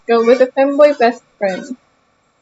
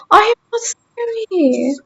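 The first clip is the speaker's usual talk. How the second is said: mock whine; 7 semitones higher, 4 dB quieter, slower than usual. Protesting being called scary.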